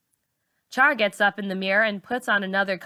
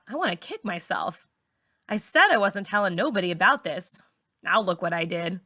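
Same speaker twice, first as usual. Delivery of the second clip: ordinary delivery, nothing marked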